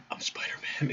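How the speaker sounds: breathy whispered line; whispering. Retold or imitated. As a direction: whisper it secretly. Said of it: "and you just whisper it".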